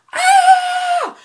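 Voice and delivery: piercing high scream of pain; reenacted scream. Recreating the scream at the salon, piercing and high. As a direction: scream as if the wax just ripped. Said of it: "I was like".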